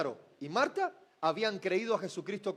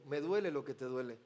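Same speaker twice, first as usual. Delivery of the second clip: "duele" almost whispered, much softer; hushed; 8 semitones lower, 8 dB quieter than usual. Acted. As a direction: breathe "me duele lo que te duele" softly. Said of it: "Jesús le podría decir". ("duele" susurrado, muy suave)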